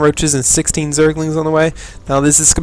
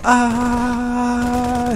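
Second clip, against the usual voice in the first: high drawn-out mock scream; marine scream; 8 semitones higher, 3 dB quieter than usual. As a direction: let out a long high cartoon yell. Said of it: "He's just screaming constantly".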